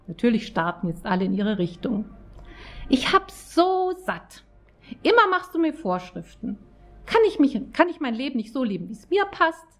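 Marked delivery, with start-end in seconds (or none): 2.9-9.7 much higher, exasperated shouting voice; angry outburst. A narrator's reading. Jessica rails at Roger about his rules, exasperated high outburst. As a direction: throw it at him in frustration.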